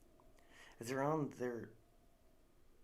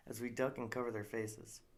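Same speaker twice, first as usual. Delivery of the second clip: ordinary delivery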